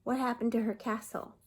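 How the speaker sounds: ordinary delivery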